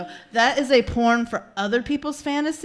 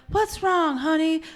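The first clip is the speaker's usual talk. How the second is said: high-pitched, put-on husbandly concern; concerned fifties husband; 7 semitones higher, slower than usual. Fifties husband asking his upset wife, high put-on concern. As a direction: ask it as an oblivious sitcom spouse. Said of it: "He's like".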